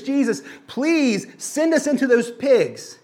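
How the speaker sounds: a hint of a pleading demon; mild begging demon. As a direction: lightly voice the demons begging Jesus. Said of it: "they are begging Jesus".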